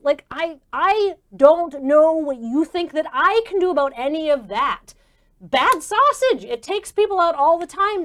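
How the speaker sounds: high, loud, insistent refusal; exasperated doctor. Acted. Doctor refusing to clear sick performers, exasperated.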